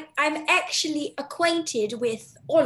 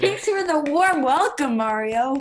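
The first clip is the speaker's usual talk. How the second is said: mock English accent; 5 dB louder than usual. Girl thanks Mario for his welcome, mock English accent. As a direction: play the English actress a little.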